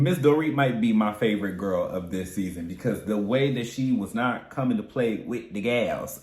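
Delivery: normal talking voice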